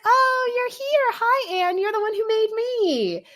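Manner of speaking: high, slow sweet fairy voice; cute little fairy. Retold or imitated. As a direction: squeak it out like a tiny adoring sprite. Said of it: "she was really cute".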